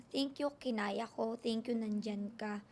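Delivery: low, soft, slow thanks; quiet thankful prayer. Retold or imitated. Woman retelling her prayer to God on a cliff, quietly grateful. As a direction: murmur a retold prayer of gratitude.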